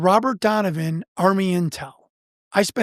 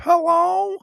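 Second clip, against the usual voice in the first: put-on old lady answering; 10 semitones higher than usual. Elderly woman answering the phone.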